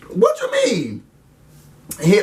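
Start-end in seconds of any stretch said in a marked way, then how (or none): 0.1-1.0 high, puzzled squeak; high bewildered question; confused reply. Retold or imitated. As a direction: play it dumb and high. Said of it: "Casey's reply".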